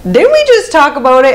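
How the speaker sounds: high, loud incredulous question; imitating a partner's disbelief; retold dismay.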